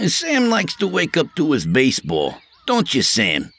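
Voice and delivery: high, nervous eager voice for Artie; Artie's voice. A narrator's reading. Artie to Will, eager to smooth things over, high and nervous. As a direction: pitch it up as jittery Artie.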